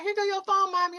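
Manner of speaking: daughter's voice. Retold or imitated. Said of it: "and she's like".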